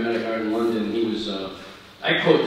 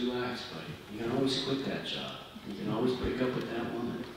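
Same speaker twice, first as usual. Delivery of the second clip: much softer, gentle advice to a younger man; soft reassurance; 3 semitones lower, 9 dB quieter than usual. Retold or imitated. Telling his young self to relax, soft and reassuring. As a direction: ease him out of his fear, kindly. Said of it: "I would say".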